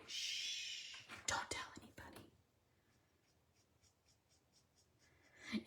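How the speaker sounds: hushed secret whisper. Real, not acted. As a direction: shush them, then whisper. Hushing viewers, secret.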